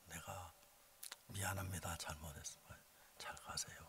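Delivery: very soft, low retold apology; hushed, conciliatory send-off; subdued apology. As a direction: drop almost to a murmur to make peace.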